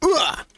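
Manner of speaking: sudden high cry of alarm; a sharp high yelp of surprise; startled cry. Acted. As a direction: yelp as the attack hits. Character cries out in attack.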